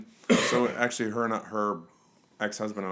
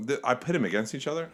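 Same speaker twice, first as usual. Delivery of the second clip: ordinary talk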